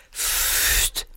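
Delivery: breathy, voiceless "pfft" of air; a puff of cold cellar wind; imitated draught whoosh. A narrator's reading. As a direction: blow a short gust through the lips.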